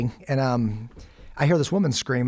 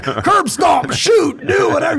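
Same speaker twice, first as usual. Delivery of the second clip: much higher and louder, shouted urges; young man's itch for a fight; 12 semitones higher, 8 dB louder, slower than usual. Retold or imitated. Recalling his young self's itch for a fight, a shouted, eager rush. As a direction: hype it up like a young hothead itching to brawl. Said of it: "As a young man, you're just like".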